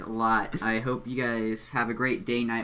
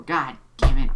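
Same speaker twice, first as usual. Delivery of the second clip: loud outburst; 2 semitones higher, 9 dB louder than usual. Real, not acted. Breaks character, sudden outburst.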